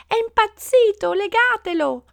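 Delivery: very high, loud outcry; stormy protest. A narrator's reading. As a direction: raise the alarm in a shrieked protest. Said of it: "le proteste diventarono un coro tempestoso".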